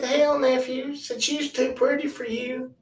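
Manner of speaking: high voice, confiding; knowing uncle. Retold or imitated. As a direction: put him wise like an old hand. Uncle explains his reasoning.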